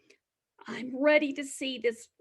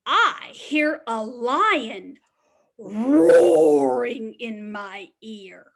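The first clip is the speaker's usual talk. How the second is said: slow, loud storybook answer, leaning in; big loud answer; 4 semitones lower, 9 dB louder, slower than usual. Acted. Teacher reads the lion line to preschool pals, slow and loud. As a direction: boom it out slowly like the big lion.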